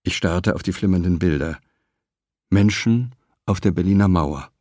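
ordinary delivery

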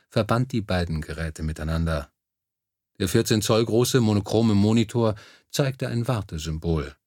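ordinary delivery, nothing marked

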